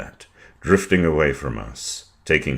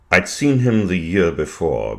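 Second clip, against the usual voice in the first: usual speaking voice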